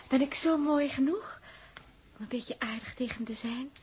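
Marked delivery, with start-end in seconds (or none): 2.2-3.7 soft, lower coaxing whisper; coy whispered plea. Acted. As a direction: murmur it shyly. Coy question, hushed.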